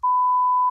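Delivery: loud, high squeal of laughter. Real, not acted. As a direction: crack up in a squeal. Laughs at emailing.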